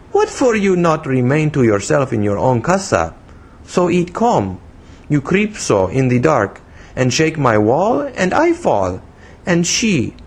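put-on high, childish foreign-accented English; broken English in a little-girl pitch; broken English, infantine. A narrator's reading. Young woman scolding the man who startled her, childish foreign accent. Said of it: "broken English that seemed as much infantine as foreign".

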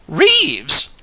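sky-high mocking repeat of the name. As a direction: throw the name back at him in scorn. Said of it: "mocked Mary Louise".

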